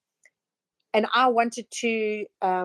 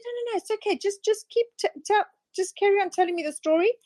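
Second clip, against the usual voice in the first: lightly voiced speaker urging her on; imitated coach; 10 semitones higher than usual. Tony Robbins voiced in retold story.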